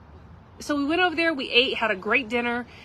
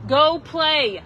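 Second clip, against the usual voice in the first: calling out; 6 semitones higher, 6 dB louder than usual. Real, not acted. Mother calling her kids away to play, loud call.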